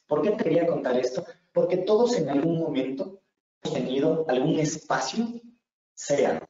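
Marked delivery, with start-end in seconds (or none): none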